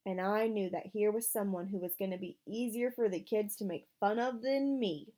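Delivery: ordinary delivery, nothing marked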